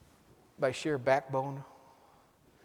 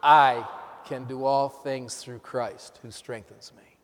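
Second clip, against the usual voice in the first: "I" loud and held apart, slow line; stress on I; 7 dB louder, slower than usual. Shown as a demonstration. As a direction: punch "I" first, then continue slowly. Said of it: "Emphasizing "I".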